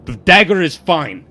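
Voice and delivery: loud, high, forceful insistence; very aggressive. Acted. Aimed at Matthias with the dagger, very aggressive. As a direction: snap it out aggressively. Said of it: "very aggressively".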